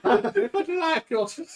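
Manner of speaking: high, laughing put-on NPC; giggling through a silly voice; laughing as the player mimics the NPC; laughing mock voice.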